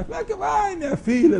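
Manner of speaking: sugary hello to the stressed coworker; sweet greeting. Retold or imitated. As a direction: greet her sweetly, pitch way up.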